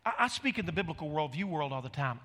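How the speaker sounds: ordinary delivery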